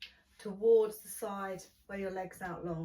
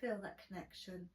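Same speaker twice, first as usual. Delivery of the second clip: guided relaxation; 7 dB quieter than usual. Acted. Guided relaxation closing the class.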